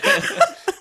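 real high-pitched laugh bursting out; high burst of laughter. Real, not acted. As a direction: break into a loud, high laugh.

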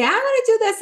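high-pitched imitation of a pleading child; eager child. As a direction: pipe up like an excited little kid. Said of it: "a child will come up and say".

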